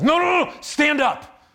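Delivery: high, loud urgent shout; retelling the coach yelling him to his feet; coach's yell. Retold or imitated. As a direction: bark it out like a drill coach. Coach yells at struggling friend.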